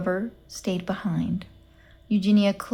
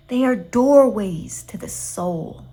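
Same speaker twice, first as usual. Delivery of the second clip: slow, sugary "doorways to the soul"; too sweet, dreamy; 5 dB louder, slower than usual. A narrator's reading. Gladys praising accordions to Eugenia, slow and syrupy. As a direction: savour "doorways to the soul" slowly, syrupy and mystical. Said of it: "in her too sweet voice".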